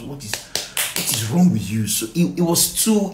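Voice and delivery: ordinary talk, nothing marked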